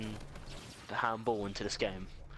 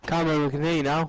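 loud call to friend; 4 semitones higher, 10 dB louder than usual. Real, not acted. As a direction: call out loudly. Calling to muted friend.

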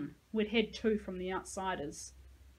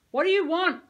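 much louder, higher shout; yell; 9 semitones higher, 11 dB louder than usual. A narrator's reading. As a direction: yell it at the gate. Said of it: "I yelled".

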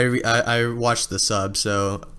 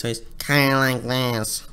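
nasal voice; slower than usual. Shown as a demonstration. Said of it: "But with a nasal voice".

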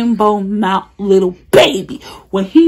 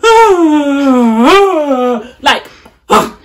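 loud mock crying wail; crybaby wail; 5 semitones higher, 9 dB louder, much slower than usual. Imitating London's constant crying, loud wail. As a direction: wail like a drama queen. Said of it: "All you hear all day".

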